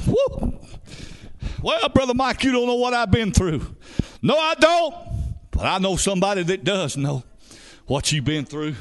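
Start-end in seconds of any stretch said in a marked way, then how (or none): 0.1-0.6 high-pitched "woo"; revival whoop. Real, not acted. Preacher's exultant whoop. 1.6-3.8 high-pitched mock protest; objecting churchgoer. 4.2-5.0 high, punched comeback; emphatic preacher's retort. Real, not acted. Preacher answers the objection.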